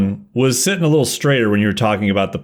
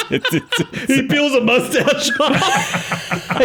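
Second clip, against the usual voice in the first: high, slow laughing words; much slower than usual. Real, not acted. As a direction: laugh through the line. Laughing through narration.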